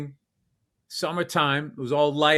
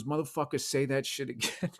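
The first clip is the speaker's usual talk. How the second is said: quiet threat; 3 semitones lower, 8 dB quieter than usual. Retold or imitated. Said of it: "he goes".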